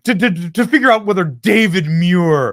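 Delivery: stammering, scornful parody; mocking imitation. Host ridiculing the moderator's excuse, mocking imitation. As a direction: make the excuse sound idiotic.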